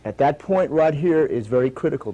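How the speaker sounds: ordinary talk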